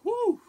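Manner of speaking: sharp pained exhale from the heat; loud high whew. Real, not acted. Reacting to extreme heat.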